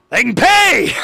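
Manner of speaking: bust out laughing at your own gag; high whooping laugh after the punchline; laughing shout. Real, not acted. Joke about government subscribers.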